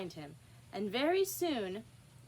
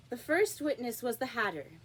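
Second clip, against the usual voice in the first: ordinary delivery, nothing marked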